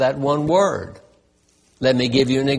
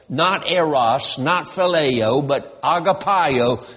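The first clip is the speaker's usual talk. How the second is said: ordinary talk, nothing marked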